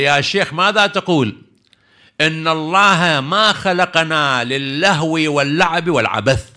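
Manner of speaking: emphatic storyteller's rebuke; boy's earnest rebuke. Retold or imitated. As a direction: retell a child solemnly challenging an elder, stretching the words. Boy rebukes the sheikh in story.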